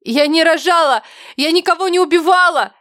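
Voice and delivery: high, loud, desperate cry; piercing scream. A narrator's reading. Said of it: "Пронзительно закричала я".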